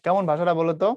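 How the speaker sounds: ordinary talk, nothing marked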